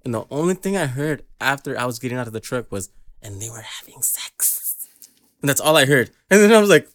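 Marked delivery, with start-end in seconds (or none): 3.2-4.9 slow, hushed whisper of an overheard line; imitated whisper. Friend retelling what he overheard at the mechanic's, whispered. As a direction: whisper it slowly, imitating an eavesdropped remark.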